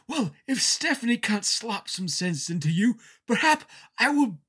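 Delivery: mock Regal. Regal threatening Rikishi.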